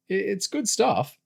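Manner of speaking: high-pitched, stammered protest; defensive and a bit indignant. A narrator's reading. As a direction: stick up for it, voice jumping up. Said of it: "Kyle said defensively".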